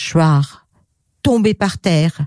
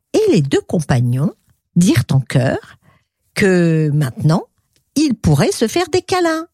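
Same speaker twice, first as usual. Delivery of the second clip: ordinary delivery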